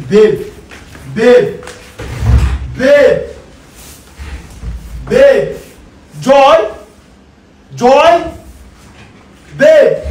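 loud, separated calls for attention; calling out. Acted. Calling out to an unresponsive partner.

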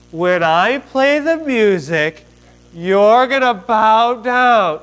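very slow, drawn-out instruction; over-patient explaining. Acted. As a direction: spell it out slowly for slow listeners. King explains order very slowly.

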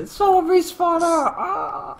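high, slow squeal through laughter; laughing mock shriek. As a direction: shriek it while cracking up. Retells the scare, laughing.

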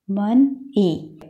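"मन", a clear pause, then "ई"; split into syllables. Shown as a demonstration. Teacher breaking a word for pupils, मन ई in two slow parts. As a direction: say मन ई slowly as two separate syllables. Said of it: "दो parts हैं".

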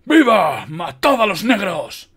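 loud, high, drawn-out war cries; rally the soldiers with big slow shouts; battle yell, stretched and forceful; shouting. A narrator's reading. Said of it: "gritó".

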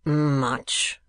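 one sneered word. A narrator's reading. Ralph scoffs at Gride's worry, sneering. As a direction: sneer it back at him. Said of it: "said Ralph with a sneer".

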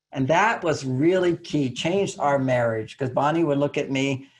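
usual speaking voice

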